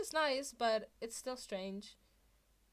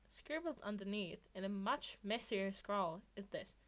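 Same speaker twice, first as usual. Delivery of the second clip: plain reading voice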